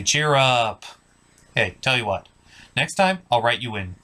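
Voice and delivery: ordinary delivery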